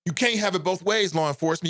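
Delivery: ordinary talk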